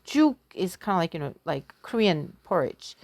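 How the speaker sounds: usual speaking voice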